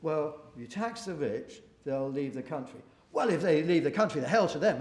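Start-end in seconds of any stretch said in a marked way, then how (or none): 3.1-4.8 louder, scornful brush-off; mock dismissive shout. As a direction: wave them away loudly in mock scorn. Mock politician dismissing the rich.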